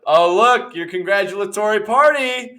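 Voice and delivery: loud, high, gushing announcement; mock delight. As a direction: gush as if greeting party guests. Panel greets arrival with Chris Pratt.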